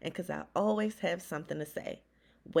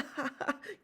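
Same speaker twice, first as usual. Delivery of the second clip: laugh; 8 semitones higher, 5 dB quieter than usual. Acted. Uncle laughs at joke.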